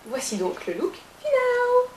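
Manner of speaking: high showy announcement; high-pitched grand reveal; mock presenter. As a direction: unveil it like a game show host. Announces the final look.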